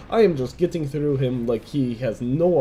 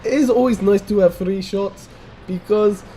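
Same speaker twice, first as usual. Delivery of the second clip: an American's playful, hammed-up Russian accent held all through; put-on Russian accent. Gamer commenting a tank replay for his viewers, in a mock Russian accent. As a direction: talk like a movie Russian, roll it thick and proud. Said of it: "I would give you my Russian accent".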